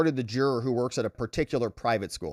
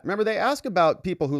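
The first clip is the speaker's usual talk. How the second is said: usual speaking voice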